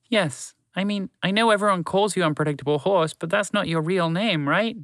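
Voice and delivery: high, timid little mouse; small mouse voice. A narrator's reading. Mouse to horse, unsure suggestion in a high mouse voice. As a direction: squeak it out gently like a tiny mouse.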